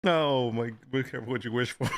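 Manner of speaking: usual speaking voice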